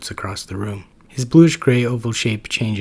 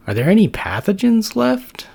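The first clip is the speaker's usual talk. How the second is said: high, loud goofy question; a dim roommate rummaging for snacks; hungry Max; 12 semitones higher, 6 dB louder than usual. Acted. Max with head in fridge.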